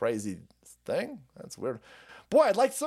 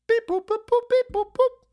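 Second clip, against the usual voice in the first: high squeaky beep boop; phone beeps; 5 dB louder than usual. Retold or imitated. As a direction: chirp like dialing tones. Imitating phone dialing tones.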